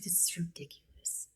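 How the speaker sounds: retold reaction in a hushed whisper; barely voiced mock disbelief; whispered aside. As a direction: whisper it like a private complaint. Retold private reaction to absurd instructions.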